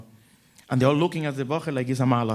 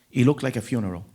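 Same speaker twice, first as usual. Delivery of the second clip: ordinary talk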